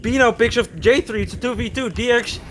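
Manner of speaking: fast, rising live commentary; excited play-by-play. Real, not acted. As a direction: call the action as it happens.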